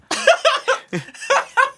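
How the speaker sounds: loud high-pitched laugh; wheezing high laugh; high squealing laugh. Real, not acted. Laughing at own story.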